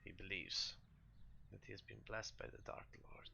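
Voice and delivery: halting and hushed, fading away; a lightly voiced priest, doubting softly; troubled priest. Priest answers the party about his son's blessing, troubled. Game master plays grieving priest.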